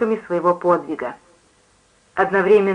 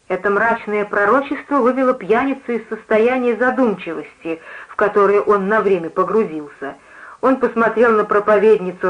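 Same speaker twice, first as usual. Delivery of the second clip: ordinary delivery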